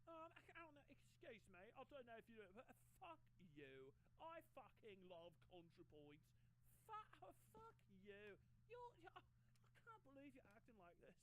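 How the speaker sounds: a lightly put-on British accent, offended and huffy; mock British accent. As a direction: take off a British accent while pretending to be insulted. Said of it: "what Brianna Wu would sound like with a British voice, uh, a British accent".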